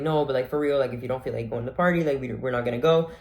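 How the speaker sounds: ordinary talk, nothing marked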